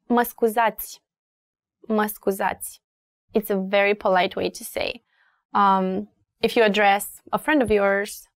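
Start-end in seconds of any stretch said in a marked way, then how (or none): none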